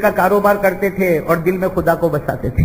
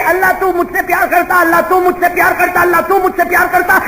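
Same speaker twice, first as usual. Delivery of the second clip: mocking repeat; 10 semitones higher than usual. Mocking her prayer loudly.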